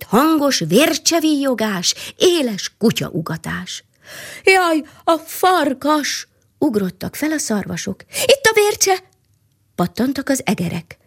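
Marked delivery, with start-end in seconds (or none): 4.4-6.3 frightened cry. A narrator's reading. Deer flee from the wolf. 8.1-9.0 panicked squeak. A narrator's reading. Mice flee from the falcon.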